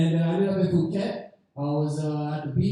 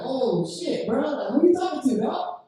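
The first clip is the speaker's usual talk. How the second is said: rising higher and higher in disbelief; mock surprised; 7 semitones higher, slower than usual. Comic reacting in shock to the stranger, rising pitch.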